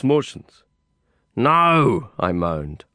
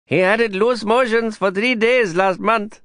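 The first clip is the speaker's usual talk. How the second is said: higher, lilting Indian-English neighbor; gossiping neighbor, chiming in; 11 semitones higher than usual. Acted. Neighbor voiced in doorway.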